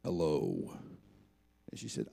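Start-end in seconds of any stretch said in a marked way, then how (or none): 0.0-0.8 very low, disguised phone hello; deep prank hello; deep put-on voice. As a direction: answer the phone in a fake deep voice to prank a caller. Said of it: "I thought I'll have some fun with them".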